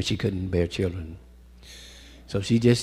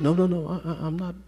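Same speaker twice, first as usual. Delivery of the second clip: pleading woman; 4 semitones higher, 5 dB quieter, faster than usual. Retold or imitated. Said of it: "So she said".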